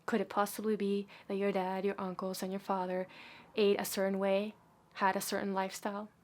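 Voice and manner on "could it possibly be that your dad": ordinary delivery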